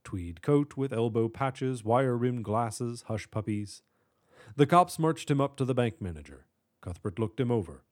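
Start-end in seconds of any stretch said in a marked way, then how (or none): none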